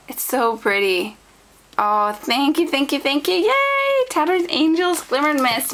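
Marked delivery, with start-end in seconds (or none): 3.4-5.7 yay squealed very high, then the name still raised; excited squeal. Real, not acted. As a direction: cheer the brand name like a prize. Cheers at her first Glimmer Mist.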